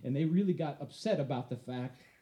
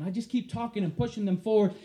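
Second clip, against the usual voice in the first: ordinary talk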